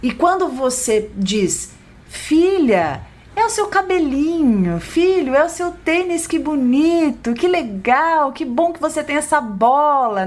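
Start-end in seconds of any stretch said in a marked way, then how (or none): 2.1-10.2 put-on high, sing-song praise to a child; doting parent voice, pitched up; affectionate parent. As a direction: admire a child's things with loving delight. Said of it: "Quando você traz com afeto".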